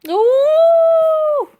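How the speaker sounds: very high loud yelp; scream. A narrator's reading. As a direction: shriek as you shoot upward. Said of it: "he screamed".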